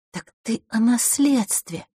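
high, slow dawning realisation. A narrator's reading. Girl grasps what her brother means, realising. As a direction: draw it out as it dawns. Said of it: "догадалась девочка".

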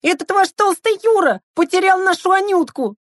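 put-on very high-pitched, urgent kid's cry; high child voice calling out in alarm; yelling little boy. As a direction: squeal the news like a frantic child. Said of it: "заорал".

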